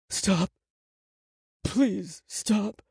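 high-pitched pained plea; anguished, high pleading; desperate begging. A narrator's reading. Beaten boy begs inwardly to stop.

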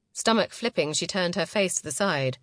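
ordinary delivery

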